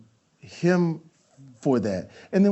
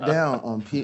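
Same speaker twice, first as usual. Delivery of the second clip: ordinary talk, nothing marked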